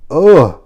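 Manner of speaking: loud, high "ugh" of exasperation; disgusted groan. Real, not acted. Vlogger who can't stand Lily, loud disgusted groan. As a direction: groan it out, fed up.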